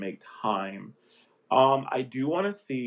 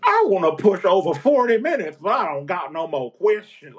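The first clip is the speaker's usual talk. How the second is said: much higher and louder, teasing imitation of a friend; mocking friend's voice; 8 semitones higher, 10 dB louder than usual. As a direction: parrot your friend back with a silly put-on voice. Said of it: "I gave you that fucking accent".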